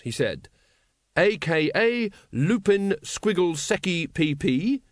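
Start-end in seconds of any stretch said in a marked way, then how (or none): 1.2-4.8 slow, high, painstaking recital; laboured notebook reading; official reading. Acted. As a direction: spell out notes like an earnest constable. Carrot reads from his notebook.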